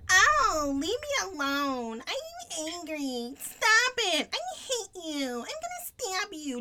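high, petulant whining brat; whiny voice. Retold or imitated. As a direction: whine it like a sulky teen. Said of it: "Just, like, this very whiny voice".

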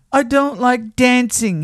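high little rabbit protest; defensive high-voiced toy rabbit; reluctant little rabbit. A narrator's reading. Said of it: "he said".

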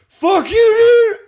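very high, loud tearful yell; mock crying rage. Beaten drunk yelling at his best friend, hammed-up crying. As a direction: wail it like a beaten, furious kid. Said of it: "and he's crying. That's like how I felt".

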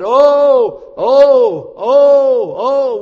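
high, loud drawn "Oh, oh, oh, oh"; preacher wailing the old English "oh". Shown as a demonstration. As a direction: moan each "oh" as a plea. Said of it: "We don't use "oh" much".

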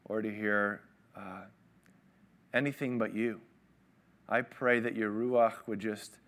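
soft, low, unhurried prayer voice; hushed heartfelt prayer. Acted. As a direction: pray quietly and humbly before the sermon. Leads the congregation in prayer.

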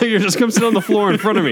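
laughing through an imitated demand; quoted request broken by laughter; laughing quote. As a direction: giggle out the bossy line. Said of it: "I'm like".

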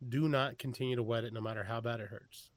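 ordinary talk, nothing marked

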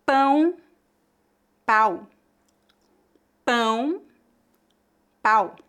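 Pão nasal, then pau with an open oral A; contrast nasal and oral. Shown as a demonstration. Teacher contrasts the two words for repetition. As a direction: alternate Pão and pau, marking the nasal difference. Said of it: "pronunciar as duas palavras para você ver melhor a diferença".

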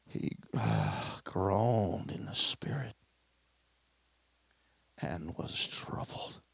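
slow hushed whisper; whispered groan. Acted. Preacher whispers how Jesus groaned, slow and hushed. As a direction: breathe it out, troubled. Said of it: "preacher whispers Jesus's groan".

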